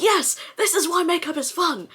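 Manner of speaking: high, loud burst of delight; excited exclamation. Retold or imitated. Retelling her reply to her partner, excitedly. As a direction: re-enact a thrilled, emphatic agreement.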